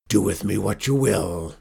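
loud, resigned pirate surrender; defeated pirate captain. A narrator's reading. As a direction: give yourself up in a pirate's voice.

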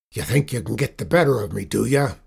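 loud, quick pirate challenge; defiant pirate captain. A narrator's reading. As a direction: challenge them as a cornered sea dog.

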